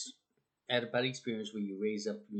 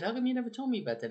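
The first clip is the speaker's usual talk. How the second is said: surprised wife; 10 semitones higher, 3 dB louder, much faster than usual. Retold or imitated. Wife confronts husband.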